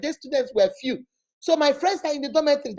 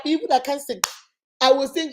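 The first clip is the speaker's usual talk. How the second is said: ordinary delivery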